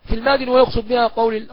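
ordinary delivery

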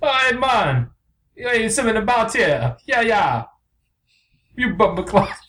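high mock Jamaican bird; hammed-up Jamaican, pitched right up; unconvincing Jamaican accent. Jamaican bird butts back in, high mock Jamaican accent.

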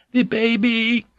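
a mild narrated shriek of fright; startled high cry for the baby. A narrator's reading. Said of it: "Kiri shrieked".

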